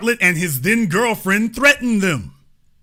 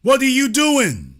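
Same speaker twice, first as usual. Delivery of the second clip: exasperated challenge; 6 semitones higher than usual. Real, not acted. Host challenges audience, exasperated.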